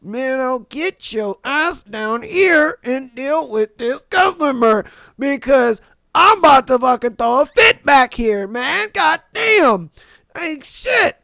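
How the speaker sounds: rising into a strained, near-shouted rant; fed-up outburst. Retold or imitated. Worker blows up about the manager hiding out back, building to a shout. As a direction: build up like you're about to throw a fit. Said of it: "it's just like".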